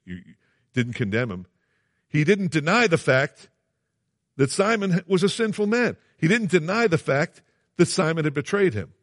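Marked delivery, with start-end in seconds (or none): 2.1-8.9 emphatic preaching. Real, not acted. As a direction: drive each word home from the pulpit. Preacher hammering a point.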